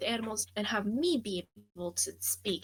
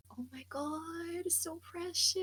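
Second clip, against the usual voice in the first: cooing; 7 semitones higher, 5 dB quieter, slower than usual. Acted. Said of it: "and go".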